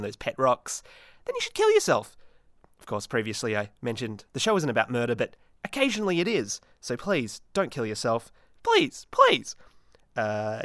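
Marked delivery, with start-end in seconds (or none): 1.2-2.2 squeaky joke punchline. Acted. 8.6-9.6 high, pleading squeak; hammed-up desperate plea; mock pleading.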